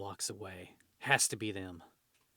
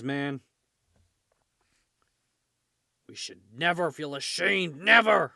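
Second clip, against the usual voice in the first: loud, higher ranting speech; rally shout; 5 semitones higher, 12 dB louder than usual. Acted. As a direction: roar it at the crowd like a fanatic.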